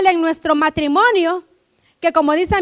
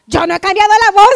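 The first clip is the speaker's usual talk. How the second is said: loud, quick mock protest; husband's protest; 3 semitones higher, 7 dB louder, faster than usual. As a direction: blurt out a hurried denial to a child. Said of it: "le dice". (protesta fingida, fuerte y apresurada)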